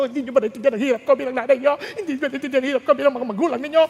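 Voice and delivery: rapid-fire scolding parent; nagging parent. Acted. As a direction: rattle off a guilt-trip at the kids. Voices a nagging parent scolding children.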